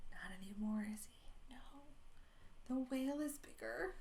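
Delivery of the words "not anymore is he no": whisper. Acted. Suspenseful aside to children.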